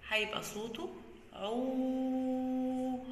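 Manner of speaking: عو held out long and slow; long vowel demo. Shown as a demonstration. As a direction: stretch the sound عو like a long vowel. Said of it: "ولما يبقى بعده مد بالواو". (مد صوت عو ببطء)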